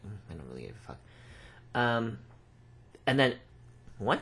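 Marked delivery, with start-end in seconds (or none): 0.1-1.0 low, quiet, couldn't-care-less mutter; low quiet I-don't-care aside; dismissive. Retold or imitated. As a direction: shrug it off under your breath. Dismissive inner reply about names.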